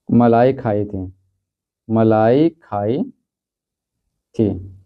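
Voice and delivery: slow, stretched dictation; teacher dictating the rewritten sentence, slow. Shown as a demonstration. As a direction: draw the words out for copying. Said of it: "तो इसको बदलेंगे".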